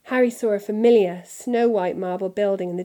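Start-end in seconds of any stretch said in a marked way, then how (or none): none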